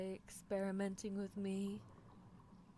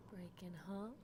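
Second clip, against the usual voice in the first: soft whispered instruction; hushed direction; 6 dB quieter than usual. Acted. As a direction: whisper it close to the ear. Breaking pills, soft instructions.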